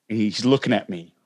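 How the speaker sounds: ordinary talk, nothing marked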